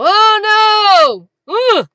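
loud, high yelping mock sleep-talk cry; imitating Henry. Retelling her husband talking in his sleep, loud high mock cry. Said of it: "occasionally he'll go".

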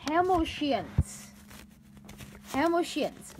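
"Hamiltians" sounded out slowly as written; reading the misspelling. Shown as a demonstration. Teacher sounding out a student's misspelled word. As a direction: spell it out "Hamiltians", stretched. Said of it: "they didn't even spell it right".

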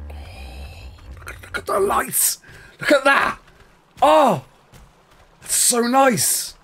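high, breathy gasp of wonder; awestruck excitement. Real, not acted. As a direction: marvel at it, pitched well up. Marvelling at the lights in game.

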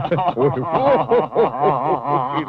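hearty roaring laugh; a big roaring laugh; lion's laughter. Acted. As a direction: laugh like a lion that can't stop. Lion roars with laughter.

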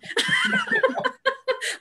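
a burst of high-pitched laughter. Real, not acted. Host laughing at her own running joke, high laugh.

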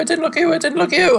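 high, frantic babble; frantic high denial; panicked pleading. Real, not acted. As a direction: repeat it desperately, high-pitched. Panics at an enemy in game.

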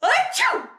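loud, high-pitched stage sneeze; big fake sneeze. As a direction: sneeze explosively, acting it out. Elephant character sneezes in story.

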